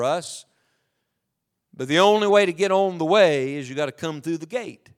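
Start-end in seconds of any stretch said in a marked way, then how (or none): none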